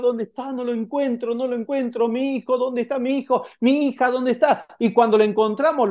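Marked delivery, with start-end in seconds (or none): 0.0-4.7 put-on high, frantic parent searching for a lost child; mock-frantic parent calling for a missing child; desperate parent. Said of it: "desesperados por todo lado y hasta con lágrimas".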